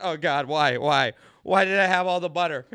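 loud mock-anguished lament; anguished regret. Driver regretting all the butter, loud comic anguish. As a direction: wail to God in exaggerated regret.

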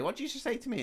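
high-pitched incredulous challenge, retold; mock indignant teacher. Host retelling the teacher challenging Bender, mock indignant. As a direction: imitate an affronted teacher, voice shooting up.

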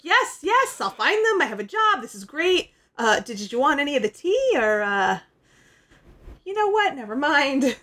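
bubbly, over-eager helper; excited Scorpia. Retold or imitated. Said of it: "She's like".

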